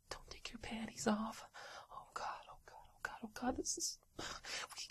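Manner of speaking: hushed, scared whispering; nervous whisper. Acted. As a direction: whisper so nobody hears. Fear of being caught.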